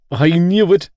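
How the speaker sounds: high, loud exclamation; worked-up outburst; loud declaration. A narrator's reading. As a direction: declare it in alarm. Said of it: "Gibbsy declared".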